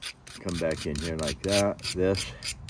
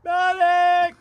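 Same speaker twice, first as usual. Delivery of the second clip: very high, loud yell for help; man down shout; 11 dB louder than usual. Pretend. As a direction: call for the medic across a field. Echoes the joke call for help.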